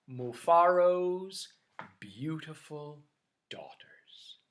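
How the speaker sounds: slow title fading to a whisper; storyteller closing the tale in a fading whisper. A narrator's reading. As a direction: draw out the ending, then breathe it.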